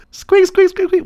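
high, tight squeaks; squeaky grape voice. Pretend. Answering as the grape, high playful squeaks.

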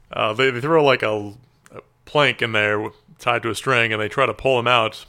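normal talking voice

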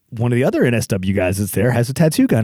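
usual speaking voice

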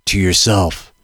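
low gravelly dramatic narrator; deep, brooding movie-narrator rasp; Mickey Rourke gravelly voice. Retold or imitated. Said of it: "Mickey Rourke's gravelly voice".

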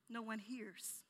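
ordinary talk

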